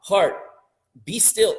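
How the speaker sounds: escalating loud imploring call; pleading command. Retold or imitated. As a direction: beg your own heart to be still, rising. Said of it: "You try to say".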